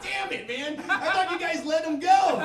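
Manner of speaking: high, loud laughing shout; high laughing shout of frustration; mock exasperated yell. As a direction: yell in comic disbelief. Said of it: "I was like".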